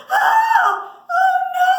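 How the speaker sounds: loud, high-pitched shriek of alarm; storybook scream. Acted. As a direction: scream out in acted horror. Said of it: "She started to scream".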